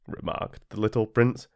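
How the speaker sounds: usual speaking voice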